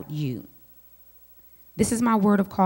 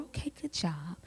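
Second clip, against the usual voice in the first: quiet approval; 10 dB quieter than usual. Retold or imitated.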